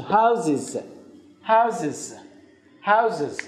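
"هاوزز" with the middle s voiced as z; two-syllable "هاوزز", z instead of s; plural with Z sound. Shown as a demonstration.

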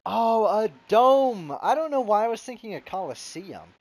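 a big loud realization; real loud surprise at the dome; surprised. Real, not acted.

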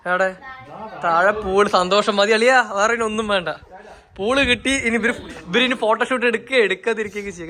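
loud, high delight with a laugh; excited. Real, not acted. Vlogger joking with brother-in-law about the pool, loud and delighted. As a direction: cheer about finding the pool.

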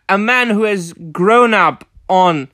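ordinary delivery

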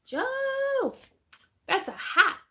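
high delighted squeal; thrilled by a gift; excited squeal. Real, not acted. Opening a surprise gift.

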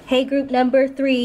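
usual speaking voice

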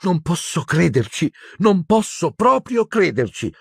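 high, wavering disbelief that builds; incredulous Hermione. A narrator's reading. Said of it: "mormorò Hermione con voce molto incerta". (incredula, voce sempre più acuta)

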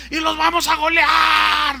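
high, drawn-out victory yell; mock Goliath boast. Organizer playing Goliath before the match, long boastful shout. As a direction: bellow it slowly to the whole stadium. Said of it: "decía el Goliat este".

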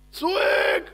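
high, loud shouted word; acted scream. Preacher tells of a man trapped in fire, high acted shout.

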